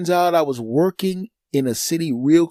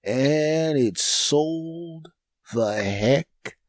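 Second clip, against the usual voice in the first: slow punchline; much slower than usual. Acted. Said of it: "slowed punchline".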